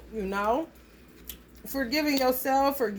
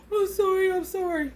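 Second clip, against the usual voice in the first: high, gasped pleading, hammed up; squeaky-high pretend panic after a gasp; mock scared apology; 9 semitones higher than usual. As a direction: imitate a kid hurrying to apologise. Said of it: "My generation probably would have like".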